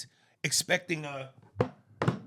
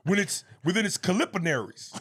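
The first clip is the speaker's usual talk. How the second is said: laughing through fake scientist talk, louder; mock science jargon; 5 semitones higher, 7 dB louder than usual. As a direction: reel off fake jargon while cracking up. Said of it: "how would Cube say that he's a scientist".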